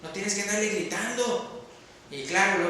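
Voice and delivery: usual speaking voice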